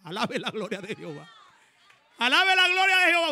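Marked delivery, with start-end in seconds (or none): 2.2-3.3 much higher and louder cry; shouted praise. Real, not acted. Preacher shouting the repeated praise to the congregation, loud and high. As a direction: shout it out to the church.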